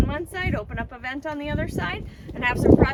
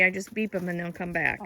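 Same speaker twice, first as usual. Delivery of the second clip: ordinary talk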